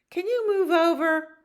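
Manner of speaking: high, loud squeaky voice; possum character. Acted. Possum crammed in the tent asks for room, high squeal. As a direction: squeal the request. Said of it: "Possum says".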